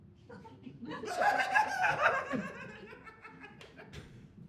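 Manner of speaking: loud, high, drawn-out fake laugh; forced laugh. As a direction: laugh along far too hard and too long. Forced laugh along with the lads.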